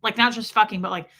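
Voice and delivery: usual speaking voice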